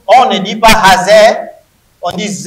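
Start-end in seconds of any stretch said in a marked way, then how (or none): 0.1-1.4 raised, firm correcting voice; loud correction. Real, not acted. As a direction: call the class to order, loud and firm. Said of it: "teacher correcting pronunciation loudly".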